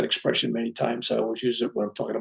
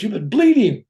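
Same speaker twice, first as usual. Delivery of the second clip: higher, louder wife's exclamation; raised voice of acted alarm; imitated alarm; 8 semitones higher, 5 dB louder than usual. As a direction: mimic a wife's startled discovery.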